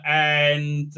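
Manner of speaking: ordinary talk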